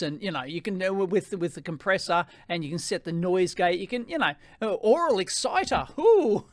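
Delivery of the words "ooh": high delighted ooh; a little gasp of interest; surprised ooh. Real, not acted. Finds aural exciter setting.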